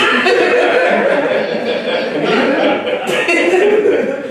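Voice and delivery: loud high laugh breaking out; real laughter; high burst of laughter. Real, not acted. Bursts out laughing at the teeth joke.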